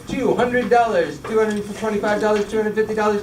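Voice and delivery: high, fast-rolling auction call; auctioneer voice. Pretend. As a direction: rattle off bids like an auctioneer. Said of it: "I kinda like my auctioneer voice".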